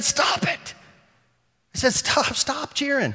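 high sharp call to stop the crowd; retold call to stop. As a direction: cut off the applause. Stopping the cheering crowd.

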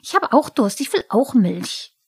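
a goblin sulking that the cat gets carried; Pumuckl the kobold, jealous. Acted. Pumuckl quengelt.

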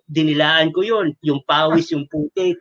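normal talking voice

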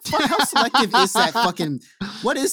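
high, loud disbelieving rant; what is that fucking logic; incredulous outburst. Real, not acted. Friend rants at Mark's selective color blindness, incredulous and loud.

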